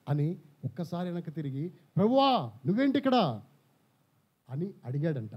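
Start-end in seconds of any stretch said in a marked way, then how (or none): 1.7-3.4 startled call. Acted. Peter startled, recognising Jesus.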